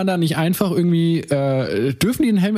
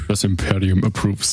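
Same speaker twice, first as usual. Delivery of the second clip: imperial villain voice; 7 semitones lower than usual. Acted. Vader-style reply.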